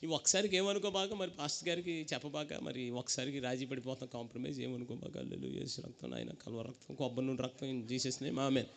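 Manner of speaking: low, soft wheedling plea; compromising old believer. Retold or imitated. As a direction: beg God to excuse this once, in a low voice. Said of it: "రాజీ పడిపోతున్నారు".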